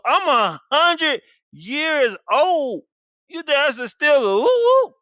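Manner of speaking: high, loud, very slow put-on voice of disbelief; old man scoffing. Abraham scoffing to God, high disbelieving old voice. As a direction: play the old man who thinks it's ridiculous. Abraham doubting he can father.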